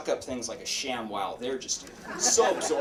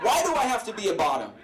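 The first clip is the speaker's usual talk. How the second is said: higher, quicker mock whine; complaining; 6 semitones higher, 3 dB louder, faster than usual. Boyfriend gripes to his partner, mock complaining. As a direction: imitate a griping boyfriend, pitched up. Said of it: "he's always complaining".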